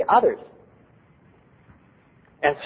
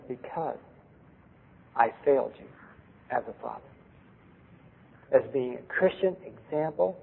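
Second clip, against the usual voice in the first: soft apology; 7 dB quieter than usual. Retold or imitated. Father's retold apology to daughter.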